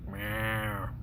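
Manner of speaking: soft, low human meow; fat cat meow. Shown as a demonstration. Showing how Butters meows, quietly. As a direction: say Meow like a person faking a cat. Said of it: "his meows sound like this".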